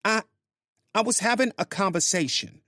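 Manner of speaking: high-pitched woman fumbling a defense; flustered Grace. Acted. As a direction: caught out, she scrambles for an excuse. Grace caught off guard.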